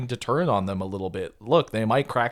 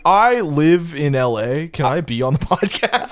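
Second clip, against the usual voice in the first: higher, louder wannabe-guest imitation; mock eager fan; 4 semitones higher, 4 dB louder, slower than usual. Host mimicking followers asking onto the show, mock eager fan. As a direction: slowly draw out the hopeful request in mockery.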